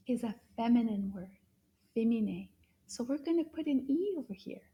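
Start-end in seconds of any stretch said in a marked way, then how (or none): none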